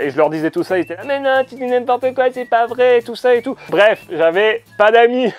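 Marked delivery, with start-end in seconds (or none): 1.1-3.6 put-on higher whiny kid voice; high-pitched childish denial; kid classmates protesting. Classmates answer him back.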